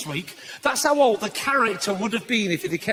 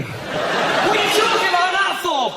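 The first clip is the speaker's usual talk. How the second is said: baffled onlooker; 6 semitones higher, slower than usual. Pretend. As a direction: shout it in disbelief. Voiced onlooker questions Robin.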